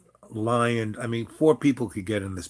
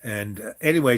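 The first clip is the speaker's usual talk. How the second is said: ordinary delivery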